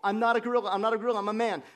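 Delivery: loud, high mock-panic cry; panicked shouting. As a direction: shout it out like a terrified kid pleading with a crowd. Kid in gorilla suit pleading, panicked.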